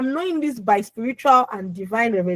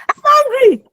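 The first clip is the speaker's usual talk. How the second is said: high, loud self-centred demand; demanding shout; 13 semitones higher, 7 dB louder than usual. Shown as a demonstration. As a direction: yell it as if nobody else matters. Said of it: "I don't care about anybody here".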